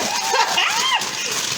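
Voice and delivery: squealing laugh, high and loud. Real, not acted. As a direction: let out a giddy squeal. Shaking the bag.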